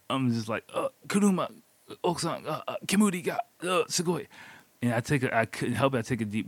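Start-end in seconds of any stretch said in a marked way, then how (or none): 0.7-4.3 high-pitched, broken Japanese with hesitations; panicked stumbling. Retold or imitated. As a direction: act out groping for each word, flustered. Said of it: "I'm stumbling, I'm panicking".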